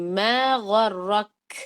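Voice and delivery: ما غرک stretched very slowly, stopping on a breathy ک; kaaf stop demo. Shown as a demonstration. Teacher gives students kaaf stop example, slow and breathy. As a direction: hold ما غرک and release air on the ک. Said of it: "یہاں کاف کی example دے رہی ہوں".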